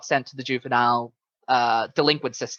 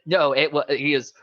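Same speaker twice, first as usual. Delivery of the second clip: ordinary delivery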